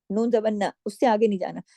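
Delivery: ordinary talk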